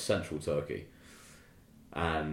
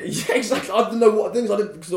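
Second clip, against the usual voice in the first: high, loud laughing agreement; 12 semitones higher, 8 dB louder than usual. Real, not acted. Laughing agreement at friend's joke.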